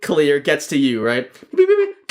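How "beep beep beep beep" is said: very high, loud electronic beeps; robot beeping. Pretend. Bouncer bot scanning people for covid, high loud beeps. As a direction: beep like a scanning machine. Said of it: "And it goes".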